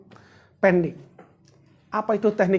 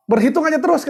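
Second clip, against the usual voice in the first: higher and louder, playful mock advice; joking loud quote; 9 semitones higher, 7 dB louder, faster than usual. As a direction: belt it out like a teasing punchline. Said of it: "Tapi kata Pak Abdul nih". (kutipan bercanda, lebih tinggi dan keras)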